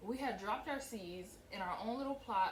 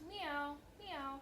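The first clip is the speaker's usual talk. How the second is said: a light, cute mock meow; cat meow; 7 semitones higher than usual. Voicing the cat in the primer with a small mock meow. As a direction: play the kitty with a high little meow. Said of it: "It goes".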